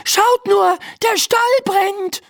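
high, urgent alarm call. A narrator's reading. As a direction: call out high to alert parents. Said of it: "ruft Laura". (hoch und dringend gerufen)

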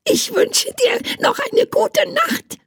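very high squeaky goodnight, fluttering its eyes; sweet tiny creature. Acted. Tiny creature bids the man goodnight, sweet squeaky voice. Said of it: "klimperte mit seinen großen blauen Augen".

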